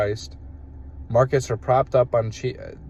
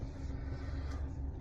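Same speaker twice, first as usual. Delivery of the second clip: harsh rattling cough; smoker's hacking cough; 16 dB quieter than usual. Real, not acted. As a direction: hack it out like a chain smoker. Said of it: "I sound like a chain smoker".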